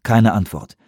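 usual speaking voice

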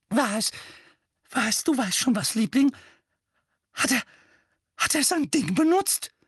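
high, loud, anxious questioning; alarmed high-pitched mother; horrified mother. Acted. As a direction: press your darling for the awful truth. Horrified mother questions her son.

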